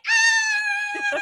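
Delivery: high-pitched mock kid wail; child's scream. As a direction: shriek like a child throwing a fit. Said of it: "And they start screaming".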